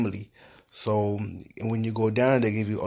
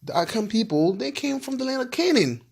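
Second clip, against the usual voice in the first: much higher, lightly imitating the Ghanaians; retold Ghanaian confirmation; 12 semitones higher than usual. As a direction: echo their eager confirmation in a raised voice. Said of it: "They say yes".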